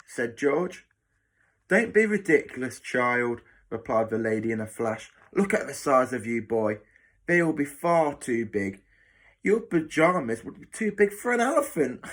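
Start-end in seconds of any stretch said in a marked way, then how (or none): 1.7-3.4 scolding. Acted. Matron dismissing George's offer of pyjamas, scolding. 5.3-12.0 higher-pitched, emphatic jibing matron; mocking matron. Matron making fun of George's size, mocking.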